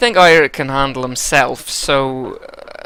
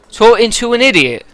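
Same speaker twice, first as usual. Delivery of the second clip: higher, louder West Country snap; irritated West Country Eirik; 10 semitones higher, 8 dB louder than usual. Acted. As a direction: brush him off in a West Country burr. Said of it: "I think West Country for this guy".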